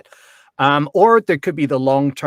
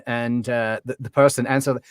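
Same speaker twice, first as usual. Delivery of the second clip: usual speaking voice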